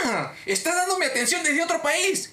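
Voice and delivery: high, slow, drawn-out discovery; indignant realisation. Retold or imitated. Cliente descubre que lo atienden desde fuera, indignado.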